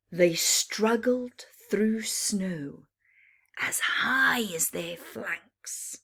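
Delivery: lively read-aloud with bouncing rhyme; rhyming story narration. A narrator's reading. Reading a rhyming bedtime book to children, lively. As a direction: tell it to children, full of drama.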